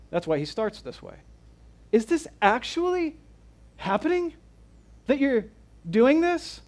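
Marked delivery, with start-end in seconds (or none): 1.9-6.6 much higher, incredulous voice; disbelief. Retold or imitated. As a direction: ask it as if it can't be true. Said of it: "he can't believe that this is happening".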